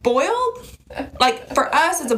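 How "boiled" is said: "Boiled?" jumped up high, disbelieving; surprised question. Retold or imitated. [name] questioning Wendy's word, high surprised "Boiled?". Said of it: "And I said".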